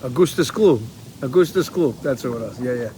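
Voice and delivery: usual speaking voice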